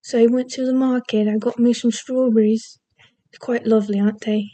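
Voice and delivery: lilting British accent; put-on British accent, slightly lifted. Shown as a demonstration. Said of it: "I can do a British accent".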